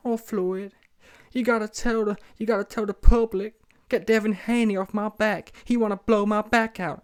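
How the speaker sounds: high-pitched mock pleading; begging.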